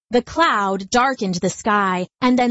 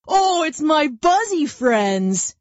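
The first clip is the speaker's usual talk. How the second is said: higher, slow, cosy bear voice; friendly bear; 6 semitones higher, slower than usual. Acted. Lazy bear greeting the buzzing bees, slow and friendly. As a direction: greet them like old pals, slowly. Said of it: "he said".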